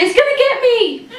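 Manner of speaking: high hammed-up cry of fright; mock fear. Pretending to flee a shopping cart, mock fright. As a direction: squeal like the cart is a monster.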